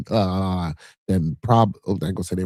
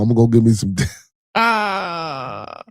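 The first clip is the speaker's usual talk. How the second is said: laughing shout; 3 semitones higher, 5 dB louder, slower than usual. Real, not acted. Joking about wanting baby oil.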